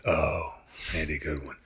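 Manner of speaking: low, soft, unimpressed sneer on the name; dismissive. Retold or imitated. Retold friend mimicking John's sneer, low and dismissive. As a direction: sniff at the name like it's nothing.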